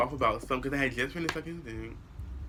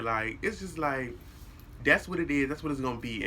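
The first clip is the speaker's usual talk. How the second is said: usual speaking voice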